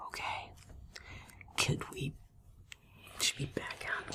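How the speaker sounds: hushed whisper. Real, not acted. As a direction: whisper so the figure won't hear. Sneaking into a crypt.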